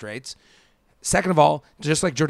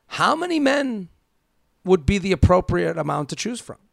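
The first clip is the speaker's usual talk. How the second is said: pitched way up in disbelief; incredulous; 3 semitones higher than usual. Real, not acted. Host questioning the letter writer's claim, incredulous. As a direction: ask it as if it's absurd. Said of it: "incredulous rhetorical question".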